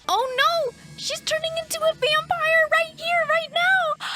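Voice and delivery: high, loud panicked outburst; sudden alarm as she transforms on screen; shrieking oh no, high and loud; alarmed shock. Real, not acted. Vampire transformation happens mid-meal.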